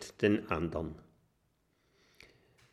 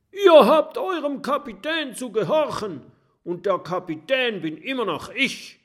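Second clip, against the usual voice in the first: much higher, loud angry yell with a laugh; furious captain; 14 semitones higher, 7 dB louder than usual. Acted. Captain Jim furiously yelling at his rebelling pirates. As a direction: rage at the mutinous crew. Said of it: "fuchtelt wütend mit seinem Haken".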